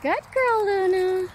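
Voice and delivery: high squeaky dog praise; squeaky praising a dog. Real, not acted. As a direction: coo up high at the dogs.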